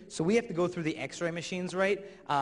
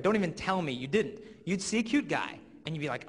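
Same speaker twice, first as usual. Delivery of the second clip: usual speaking voice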